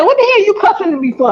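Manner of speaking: high, fast scolding grandma; scolding grandmother. Retold or imitated. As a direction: snap at him like an angry grandma.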